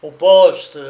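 loud, curt command; stern order. Acted. The policeman orders the hawker to the station, loud. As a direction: bark the order to go.

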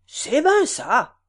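Rousseau agreeing. A narrator's reading. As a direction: drag it out slowly. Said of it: "répondit Rousseau".

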